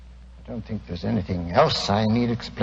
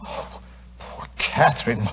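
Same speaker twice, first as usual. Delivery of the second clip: slow, breathy murmur of pity; pitying sigh; 6 dB quieter, slower than usual. Acted. Husband sighing over his worried wife, slow and hushed.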